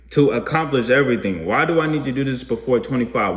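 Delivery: ordinary talk, nothing marked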